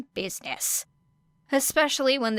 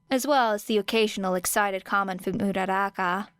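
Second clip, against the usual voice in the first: ordinary delivery, nothing marked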